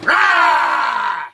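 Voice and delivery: huge sustained roaring yell; creature's yell. Retold or imitated. Storyteller imitating the thing yelling at them, loud roar. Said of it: "this thing starts yelling".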